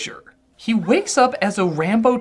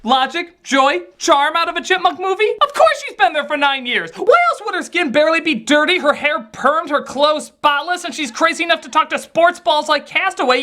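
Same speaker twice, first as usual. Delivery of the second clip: high-pitched, incredulous tirade; exasperated rant; 9 semitones higher than usual. Acted. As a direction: rant it high and indignant, building to disbelief. Exasperated rant about nine-year plot.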